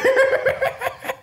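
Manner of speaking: squealing high laugh. Real, not acted. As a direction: burst into a high giggle. Laughing after impression.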